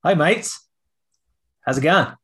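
exaggerated Aussie hello; mock Australian accent. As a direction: put on a hammy Australian accent. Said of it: "like a Texas Australian".

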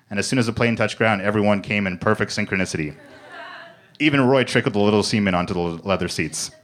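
ordinary delivery, nothing marked

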